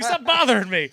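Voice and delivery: loud, high mock yell through laughter; imitated irritated outburst, half laughing. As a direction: shoo off a pest, hammed up. Voices [name] snapping at [name].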